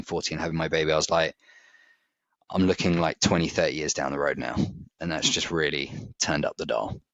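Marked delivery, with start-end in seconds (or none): none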